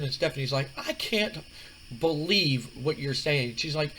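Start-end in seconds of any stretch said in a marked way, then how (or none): 0.7-3.6 higher, drawn-out disbelieving woman's voice; can't believe her ears; Stephanie, disbelieving. Retold or imitated. Stephanie voiced in retold scene.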